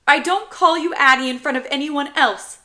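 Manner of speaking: put-on high, loud little boy protest; protesting child. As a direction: jump in defensively as a kid. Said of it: "protesting before I have a chance to".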